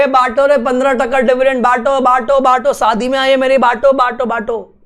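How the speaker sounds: mock rowdy shareholder chant; shareholders yelling. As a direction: hype up the crowd, half-mock shout. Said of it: "चिल्ला दिए".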